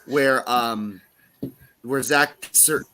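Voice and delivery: ordinary talk, nothing marked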